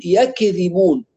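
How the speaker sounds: يكذبون with an echoing vowel after k, yak-e-dhibun; bounced kaf. Retold or imitated. Teacher mimics some people's qalqala error, bounced kaf. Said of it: "يجي بعض الناس أحيانًا يقلقلها".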